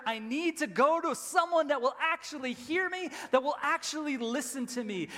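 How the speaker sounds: loud, high preaching at full force; impassioned preaching. Real, not acted. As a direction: drive the point home like a preacher.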